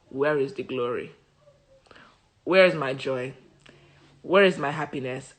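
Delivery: each question sinking into grief; mourning mother's hushed lament; grieving mother. Retold or imitated. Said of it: "She is so upset".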